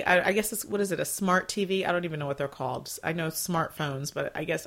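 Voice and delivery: usual speaking voice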